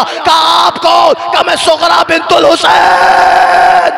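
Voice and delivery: loud, slow-starting preached lament; fervent mourning preaching; preaching at full force. Acted. Sughra meets Sajjad, retold.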